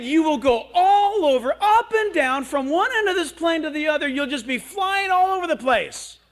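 loud, high, hammed-up warning; big escalating scold, far above normal pitch; overblown motherly threat. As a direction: imitate a mom getting carried away with the danger. Mother exaggerates threat to boy, retold.

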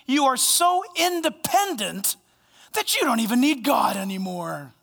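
slow, hammed-up sarcastic praise; exaggerated mockery. Preacher voices Paul mocking the Corinthian church, exaggerated mockery. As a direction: gush in grand, drawn-out mock admiration. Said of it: "let me exaggerate it like this".

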